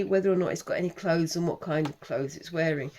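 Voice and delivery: normal talking voice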